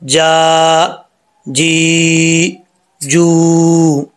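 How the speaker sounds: ता, ती, तू each long and sustained; drawn-out Quranic syllables. Shown as a demonstration. Teacher demonstrating the vowel sounds to students, long sustained recitation. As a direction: chant ता ती तू slowly with long vowels. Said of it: "ये इसका sound है".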